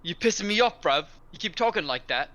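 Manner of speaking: loud, high mock South London threat; hammed-up South London hard man; South London accent. Friends trading South London insults, mock threatening. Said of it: "South London, yeah?".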